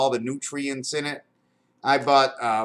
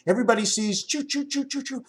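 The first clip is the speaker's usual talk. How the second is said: train choo-choo imitation; 8 semitones higher than usual.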